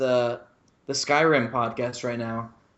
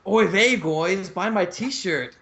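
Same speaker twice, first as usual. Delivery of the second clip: put-on high, slow caricature of a New York accent; mock New York accent; 5 semitones higher, slower than usual. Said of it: "New York, uh, accent".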